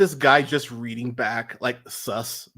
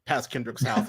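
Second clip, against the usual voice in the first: ordinary talk, nothing marked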